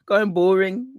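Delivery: Brummie accent, mocking and high. Teasing Birmingham viewers in a mock Brummie accent. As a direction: take off a Brummie, jokey. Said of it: "You're from Brum".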